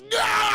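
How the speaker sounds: high, very loud "no" yell; mock anguished scream. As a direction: scream it out as if in a nightmare. Voicing Logan's nightmare cry.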